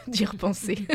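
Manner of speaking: laughing it off; nervous little laugh through the words; embarrassed laugh. Real, not acted. Guest admits she still can't think back on theatre school, laughing awkwardly.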